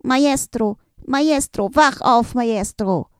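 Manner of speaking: high, loud, panicked call to wake someone; a small figure calling urgently for the Maestro; desperate calling out. A narrator's reading. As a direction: shout him awake in a panic. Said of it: "kam hinein und rief verzweifelt".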